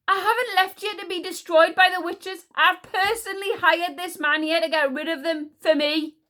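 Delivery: high, loud queenly tirade; indignant. A narrator's reading. Queen defending herself to the magical people, indignant.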